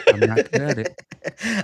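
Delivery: slow, laughing through the words; real laughter while admitting it. Real, not acted. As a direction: laugh it out, embarrassed.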